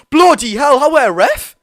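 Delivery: real, loud, high-pitched outburst at the referee; outraged shout. Real, not acted. Fan yelling at the ref over Botman being fouled, outraged shout. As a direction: yell in protest at a bad tackle.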